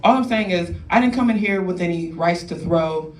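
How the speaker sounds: ordinary talk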